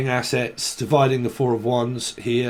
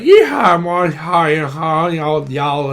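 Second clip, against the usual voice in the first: hammed-up Southern drawl; 5 dB louder, slower than usual. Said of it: "talks a little bit like I do".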